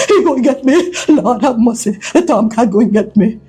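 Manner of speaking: high-pitched, trembling-with-fear babble; fearful babbling. Acted. Cousin dreads going back home, babbling fearfully. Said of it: "still trembling with fear ... and babbling".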